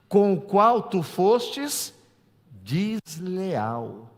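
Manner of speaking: slow, drawn-out reading of the verse; solemn scripture reading. Acted. Pastor reading Malachi to the church, slow and solemn. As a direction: read it weighty and slow.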